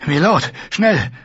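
high breathless messenger call; urgent panting report. Acted. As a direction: gasp it out urgently. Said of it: "rannte ein Bote keuchend". (keuchende Dringlichkeit)

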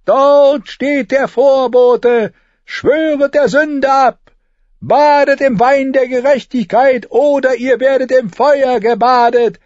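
high, loud cry of doom; ranting street prophet, shrill. A narrator's reading. Prophet pointing at the comet, urging repentance, shrill scream. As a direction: shriek a warning to sinners.

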